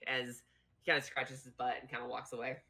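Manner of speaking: usual speaking voice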